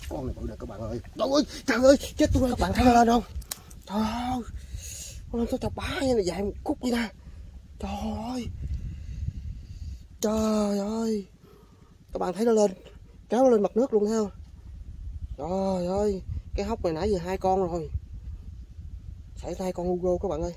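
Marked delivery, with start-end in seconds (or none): 1.2-3.2 much higher, louder thrilled cry; real excited yelling; excited shout. Real, not acted. Big fish surfaces. 3.9-20.5 much higher, breathless excitement; real excitement, voice up high; excited, high. Real, not acted. Recounting the big fish.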